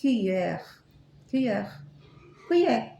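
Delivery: "Cuillère" said in French, kwee-YEHR, repeated; teacher demonstrating a French word; French pronunciation. Shown as a demonstration. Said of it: "Você sabe como é em francês?".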